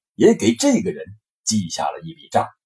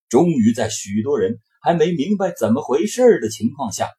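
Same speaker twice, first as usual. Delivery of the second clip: ordinary delivery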